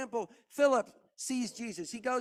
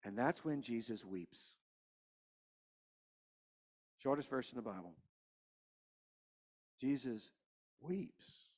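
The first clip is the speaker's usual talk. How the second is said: preacher quietly mourning with the congregation; hushed grieving preaching; 9 semitones lower, 10 dB quieter than usual. Real, not acted. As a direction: let the grief sink the voice down. Quiet grieving sermon close.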